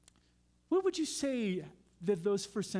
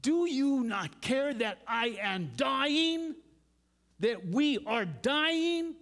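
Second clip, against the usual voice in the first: high, loud, slow plea; slow anguished outcry; emphatic desperate cry; 7 semitones higher, 4 dB louder, much slower than usual. Retold or imitated. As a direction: press every word like you're dying. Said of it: "It has that kind of emphasis".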